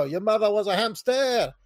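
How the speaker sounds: mock French taunt; terrible French accent. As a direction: throw out an insult in hammed-up French. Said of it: "That's terrible accent".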